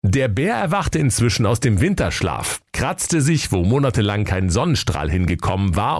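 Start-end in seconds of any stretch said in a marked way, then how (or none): none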